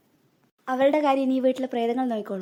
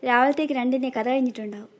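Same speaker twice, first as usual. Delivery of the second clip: ordinary talk, nothing marked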